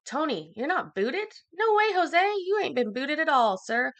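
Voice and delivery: high, loud, sing-song tease; playful teasing. Real, not acted. Teasing Tony in chat that he's not booted, high playful voice. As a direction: playfully rib the viewer.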